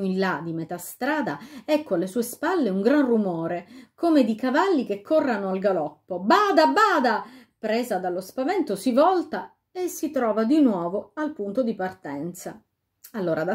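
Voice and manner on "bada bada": high, loud cry of alarm; urgent warning shout. A narrator's reading. A voice behind the king shouts a warning. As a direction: yell a warning over hoofbeats.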